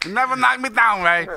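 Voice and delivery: high-pitched taunt from a boxing film; acted movie boxer. Trading the same Raging Bull line.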